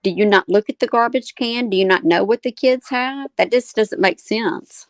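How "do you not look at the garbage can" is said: ordinary talk, nothing marked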